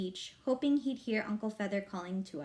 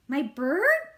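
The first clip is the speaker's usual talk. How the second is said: calling out; 4 semitones higher, 8 dB louder than usual. A narrator's reading. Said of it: "Fudge called from under the table".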